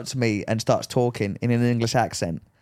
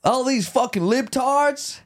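high, slow Southern sneer; 11 semitones higher, much slower than usual. Shown as a demonstration. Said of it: "I'm trying to impersonate".

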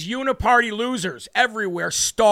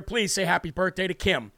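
ordinary talk, nothing marked

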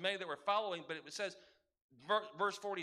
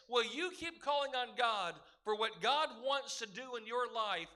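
normal talking voice

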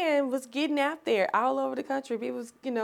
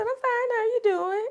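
much higher, eager how you doing; higher-pitched, thrilled phone greeting; excited greeting; 14 semitones higher, 4 dB louder than usual. Retold or imitated. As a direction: answer the call up high and eager. Retold phone call with publisher.

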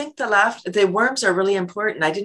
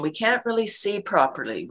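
usual speaking voice

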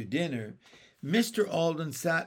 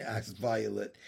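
usual speaking voice

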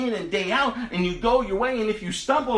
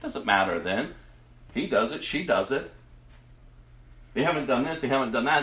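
dismissive onlookers; 7 semitones lower than usual. Retold or imitated. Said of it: "and they're like".

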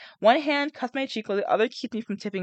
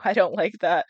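usual speaking voice

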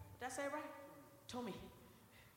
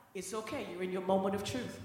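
reassuring; 7 semitones lower than usual. Retold or imitated. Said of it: "and I'd be like".

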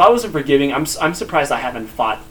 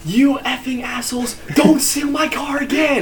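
retold yell; 9 semitones higher than usual. Owner retells yelling at friends who stole his car. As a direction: holler at them to leave your car. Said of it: "and I yell".

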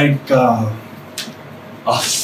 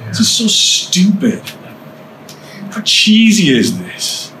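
higher, drawn-out mocking voice; scoffing inner voice; 6 semitones higher, slower than usual. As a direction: play a slow, scornful heckler. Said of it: "I heard that voice all the time".

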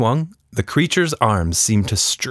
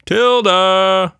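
loud calling out the name, rising; father calling; 11 semitones higher, 6 dB louder than usual. A narrator's reading. Father calling Tilda from the house, loud call. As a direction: call across the yard for your daughter. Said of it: "her father called again".

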